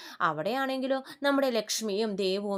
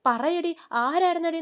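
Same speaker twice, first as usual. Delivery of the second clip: loud, high shouted demand; furious demand; 6 semitones higher, 5 dB louder than usual. A narrator's reading. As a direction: yell at her to confess. Mother beating daughter for answers. (ദേഷ്യത്തോടെ അലറുന്ന ചോദ്യം)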